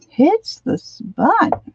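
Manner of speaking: high, slow sing-song; drawn-out silly echo. Shown as a demonstration. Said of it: "whew, that really hits the spot".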